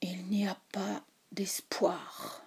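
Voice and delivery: slow, hushed whisper of despair; overwhelmed slowness. A narrator's reading. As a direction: breathe it out, crushed and slow. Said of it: "avec une lenteur accablée".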